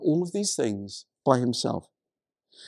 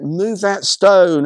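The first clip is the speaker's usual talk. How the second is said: louder, drawn-out acted command; shouted order; 3 semitones higher, 7 dB louder, much slower than usual. Jesus marching to the tomb, acted shout at bystanders. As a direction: call it out slowly and forcefully. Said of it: "then he shouts out".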